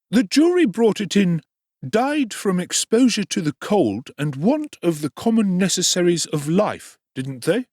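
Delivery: high ingratiating tradesman; oily undertaker. Acted. Undertaker voiced in story.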